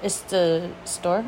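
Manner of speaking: low, hushed and slow shop-lady answer; soft, knowing cashier. Retold or imitated. Cashier explains the crying, soft and slow. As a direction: she reassures Anella, hushed and slow. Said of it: "She's like".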